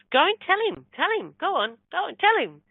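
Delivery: high, insistent nagging in short bursts; pestering spirit. Retold or imitated. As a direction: imitate a spirit pestering someone to act. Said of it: "he gets at me, at me, at me".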